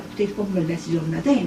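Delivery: fast throwaway line; quick quiet explanation; rushed aside. Real, not acted. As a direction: hurry through it.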